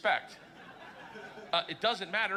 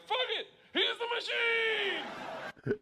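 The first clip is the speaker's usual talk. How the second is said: much higher, hammed-up crowd voice; mock gangster cheer; much faster than usual. Gangsters accepting him, hammed-up higher cheer. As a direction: cheer it like a room of drunk gangsters. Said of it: "they're like".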